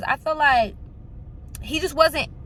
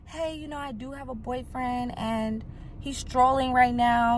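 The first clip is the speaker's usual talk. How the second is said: very soft, tentative retold excuse; hushed excuse; 2 semitones higher, 5 dB quieter than usual. Woman slipping her lie to a pushy man, very quietly.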